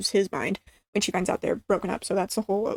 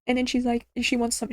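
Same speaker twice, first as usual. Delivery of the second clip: normal talking voice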